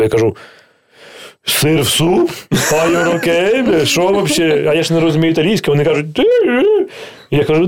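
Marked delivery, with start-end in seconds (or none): 1.4-4.7 slow, incredulous outburst at cheese in soup; can't believe what they're doing to the soup; shocked disbelief. Retold or imitated. Telling Italian hosts he is baffled by cheese in soup, incredulous. 6.1-6.9 high, far-off mock host voice asking a question; Italian host. Italian host questioning the guest, high mock voice.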